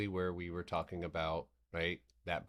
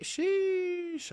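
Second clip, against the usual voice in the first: drawn-out sheesh; 10 dB louder than usual. Real, not acted. Reacting to IWM jump.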